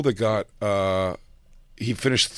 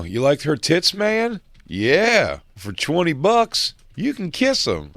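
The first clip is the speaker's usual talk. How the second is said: higher-pitched acted movie dialogue; movie tough guy imitation; 7 semitones higher, slower than usual. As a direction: play a hammed-up Roadhouse guy making an offer. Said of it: "the old Roadhouse move".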